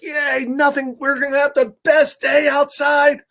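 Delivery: high-pitched, gleeful cheering; excited cheer. Acted. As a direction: read it as eager friends celebrating. Characters cheer their plans.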